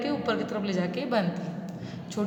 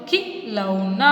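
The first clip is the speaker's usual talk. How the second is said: slow drawn-out reading; 3 semitones lower, much slower than usual. Shown as a demonstration. Teacher reading the word slowly to children. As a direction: draw खिलौना out slowly, syllable by syllable. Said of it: "तो क्या बन गया".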